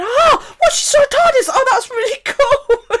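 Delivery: high, excited imitation of impressed friends; mock amazement. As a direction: gush over the news, laughing by the end. Mimics impressed people on phone.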